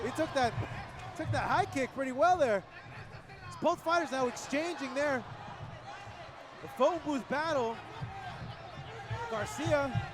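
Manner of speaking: excited play-by-play. Real, not acted. Big exchange, commentator hyped.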